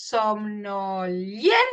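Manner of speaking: SOMNOLIEN syllable by syllable, rising on a long LIEN; stressed syllable demo. Shown as a demonstration. Teacher locating the tonic E, word broken off on LIEN. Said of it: "y es la E".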